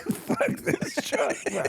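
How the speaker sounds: high, slow curse broken by laughter; cracking up while cursing the truck; laughing curse. Retold or imitated. Driver gives up on his unlucky truck, laughing.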